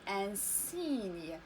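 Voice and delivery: "ان سيني" low and slow with an s; model pronunciation. Shown as a demonstration. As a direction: stretch the si in "سيني". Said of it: "إذا غادي نطقوها". (نطق "ان سيني" ببطء وبصوت السين)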